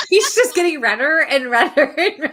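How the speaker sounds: speaking through laughter; laughing. Real, not acted. Teasing a blushing friend, laughing through the words. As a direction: laugh while teasing him.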